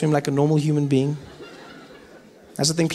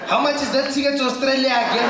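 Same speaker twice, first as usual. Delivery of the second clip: lightly played worried voice, pitched up; higher-pitched imitation of someone panicking; mock panic; 7 semitones higher than usual. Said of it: "that's when you heard people panicking".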